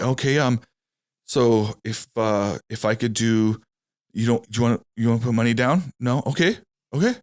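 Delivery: hesitant, halting mock of a nervous rookie. A rookie salesman pitching a customer, imitated nervous and halting. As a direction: stumble through it like a scared new salesman. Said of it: "he's, like, so nervous".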